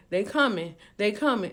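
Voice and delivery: ordinary delivery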